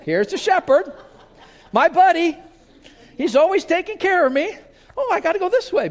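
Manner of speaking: giddy high sheep voice with a laugh; naive sheep voice. Acted. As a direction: play the cheerful, clueless sheep heading up the ramp. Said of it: "Now imagine you're the sheep".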